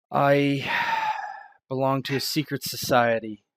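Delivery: loud, grave admission; a touch of gravity in her voice; solemn reveal. Acted. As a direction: weigh each word, like a confession. Miranda reveals secret society.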